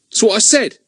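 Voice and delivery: put-on high, sulky boy's mutter. Ern sulks at Daisy, high and sulky. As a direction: grumble it, put out. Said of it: "looking sulky".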